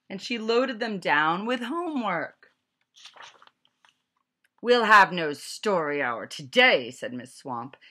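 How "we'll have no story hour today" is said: loud, harsh order; stern teacher command. A narrator's reading. Strict teacher barking orders at the class, loudly. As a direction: bark it at the class.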